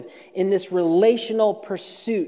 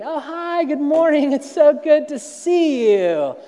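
much higher, bright put-on greeter voice; fake cheerful greeting; 9 semitones higher, 5 dB louder, slower than usual. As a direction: chirp it like an Instagram-ready greeter.

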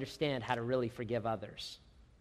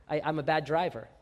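normal talking voice

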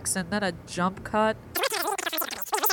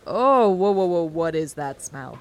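disgusted by smell; 4 semitones lower, 5 dB louder than usual. Acted. Reacts to foul smell.

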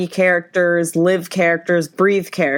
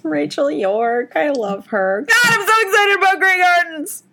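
fangirl gush; 8 semitones higher than usual. Real, not acted. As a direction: gush like a superfan, then let it burst out. Fangirling over the show.

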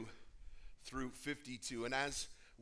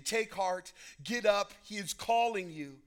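raised, urging call; 7 semitones higher, 8 dB louder than usual. A narrator's reading. Crowd summons the blind man to Jesus, calling out. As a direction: call someone over with encouragement.

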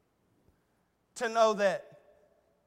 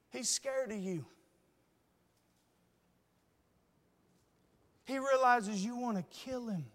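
tender plea; 5 dB quieter than usual. Acted. Jonathan pleading with Saul for David.

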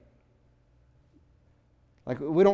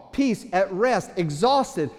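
ordinary talk

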